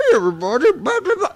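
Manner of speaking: mocking a friend's stock character voice; put-on greeting sliding into high babble; all-purpose impression voice. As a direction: do an exaggerated one-voice-fits-all imitation. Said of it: "that's the voice used to do for everybody".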